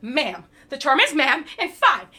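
building to a furious yell; angry. Acted. Angrily dismissing the courier.

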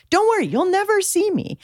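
high singsong promise; retold mom's reassurance. Mom promises son she'll stay hidden at the dance, high coaxing reassurance. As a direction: pitch it way up, coaxing a reluctant kid.

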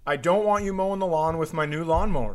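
ordinary talk, nothing marked

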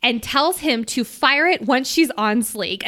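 ordinary talk, nothing marked

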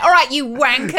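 loud, high mock yob greeting; coming in too strong. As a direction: belt it out like an over-keen lout. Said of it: "maybe don't come in and be like".

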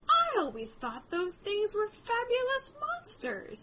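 high, slow, drawn-out wonder; the Unicorn. Retold or imitated. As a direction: play a unicorn marveling at a fabled creature. Unicorn marvels at Alice in story.

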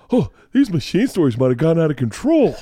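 high, exaggerated comic exasperation; Brian Regan. Storyteller borrows the comic's voice mid-story, high comic impression. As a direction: slip into a goofy observational comic's voice. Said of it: "I'm doing Brian Regan".

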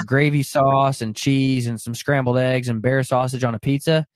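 ordinary talk

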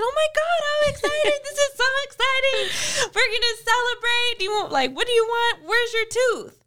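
high, gushing mom voice; overexcited mom. Retold or imitated. Mom gushing to her son about his tooth. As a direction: gush over the lost tooth, pitch way up. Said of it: "I said".

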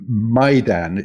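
MAIDAN said MY-dan. Shown as a demonstration. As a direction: open MAIDAN with the word "my". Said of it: "just like that. "My".

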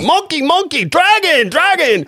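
high, hammed-up fake Chinese accent chant; mock Chinese accent. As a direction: caricature a Chinese gambler chanting at the table. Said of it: "Sounded racist".

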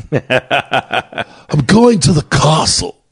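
slow, stiff, put-on English accent, laughing; mock Keanu Dracula accent. Mocking Keanu Reeves in Dracula, slow stiff English accent. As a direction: drag out a wooden period-film line, half laughing. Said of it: "Keanu Reeves in Dracula".

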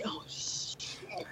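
barely voiced swear under the breath; hushed, secret expletive; whispered curse. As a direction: whisper it, as if it slipped out. Retold curse under her breath.

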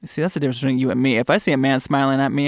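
usual speaking voice

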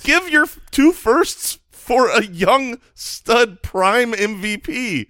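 high, loud, slowed right down; insistent and drawn out; emphatic outburst. Real, not acted. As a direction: lay out the deal word by word. Heated point about trade value.

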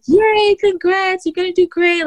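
imitating cheering nurses. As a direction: mimic the nurses' sing-song cheer. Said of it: "they get so excited".

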